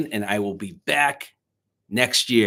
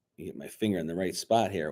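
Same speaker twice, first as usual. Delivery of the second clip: ordinary delivery, nothing marked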